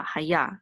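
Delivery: haya as "ha-YA"; stress on last syllable. Shown as a demonstration. Teacher pointing out the trope mark, haya stressed on ya. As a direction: put the accent on the ya of haya. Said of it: "So it's on ya".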